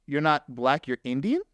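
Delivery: usual speaking voice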